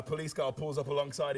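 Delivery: ordinary talk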